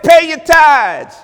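loud, slow, high preacher's shout; booming pulpit shout; shouted command. Acted. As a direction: thunder it out like a divine order.

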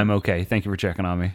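tender, hushed reassurance; gentle parent. Real, not acted. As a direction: thank your little one softly. Aside to his child.

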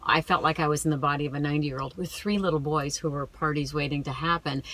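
ordinary talk, nothing marked

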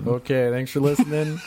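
high, silly outro voice; high silly sign-off. Pretend.

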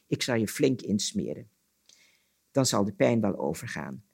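measured storyteller's reading voice; story narration. A narrator's reading. Storyteller reading an Anansi tale aloud, story narration. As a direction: tell it as a folk tale, pausing between sentences.

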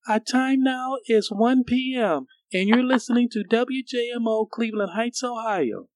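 put-on very high, slow, stiff kid announcer; imitating his teenage self. Fourteen-year-old gives first legal ID, imitated teenage announcer voice. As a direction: do a squeaky young rookie on air, drawn out. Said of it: "this is why I sounded".

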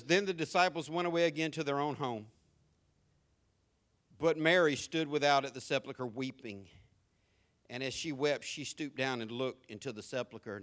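quiet, measured Bible reading; scripture reading. Acted. Preacher reading John to the church, quiet and measured. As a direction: read the verse softly to the congregation.

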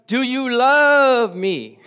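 loud, high, drawn-out plea of love; pleading question. Retold or imitated. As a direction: stretch each word, begging Peter. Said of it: "Jesus said to Simon Peter".

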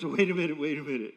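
loud, urgent cut-in; sudden emphatic objection; acted interjection. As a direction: burst in loudly to halt someone. Acted quote of Job interrupting.

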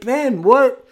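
high, loud, stunned question; a DM floored by a player's move; stunned disbelief. Retold or imitated. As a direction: blurt it out in disbelief. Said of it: "I was like".

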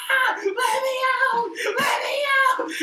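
high, drawn-out wailing plea; mock-terrified scream, loud and tearful; imitated screaming. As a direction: scream it like a panicked rider begging for escape. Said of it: "all you hear is him screaming".

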